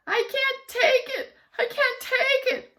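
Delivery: very high, loud sobbing shout; crying and shouting. A narrator's reading. Teacher crying and shouting in a tantrum. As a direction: wail it through tears. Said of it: "crying and shouting".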